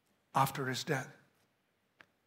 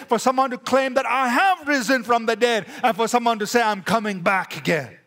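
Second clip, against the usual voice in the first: loud, higher, driving sermon voice; full-force preaching; 6 semitones higher, 5 dB louder than usual. Acted. Preacher proclaiming the resurrection to the congregation, fervent. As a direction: preach it with fire. Building list of claims.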